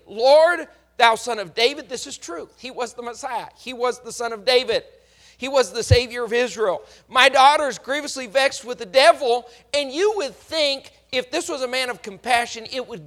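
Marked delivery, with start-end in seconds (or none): 0.1-1.9 loud, urgent appeal to the Lord; pleading cry. Retold or imitated. Canaanite woman calls out to Jesus, loud plea. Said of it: "She says the right words superficially". 7.1-9.5 daughter's plight cried out loud; louder plea. Retold or imitated. Mother cries out for her vexed daughter, louder plea. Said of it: "She says the right words superficially".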